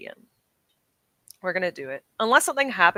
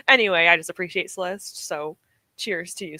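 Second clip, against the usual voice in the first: usual speaking voice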